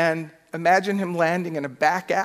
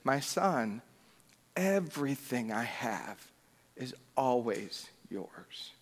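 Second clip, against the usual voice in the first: soft, slow and tender; a softer, slower fatherly voice; loving father; 4 semitones lower, 10 dB quieter, slower than usual. Retold or imitated. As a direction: reassure the son with pure love. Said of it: "pure love".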